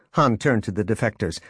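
usual speaking voice

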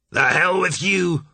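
high, loud, slow furious roar. A narrator's reading. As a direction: bellow it with hate. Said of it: "Marsh roared at Julian".

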